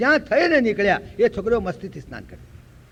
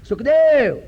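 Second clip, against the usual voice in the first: high, loud drawn-out call; 6 semitones higher, 6 dB louder, slower than usual. Acted. Vyas calls after his fleeing son Sukhdev, shouting. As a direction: shout after a runaway son, stretching the name. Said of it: "જ્યારે બૂમ પાડે".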